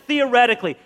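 usual speaking voice

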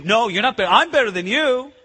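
put-on childish comeback. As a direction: top the other's brag. Disciples bickering over who is greatest.

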